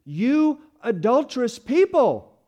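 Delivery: loud, slow cry of accusation; emphatic shout. Retold or imitated. Preacher voicing James shouting at Christians, slow and loud. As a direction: shout it slowly at the crowd. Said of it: "he shouted to them emphatically".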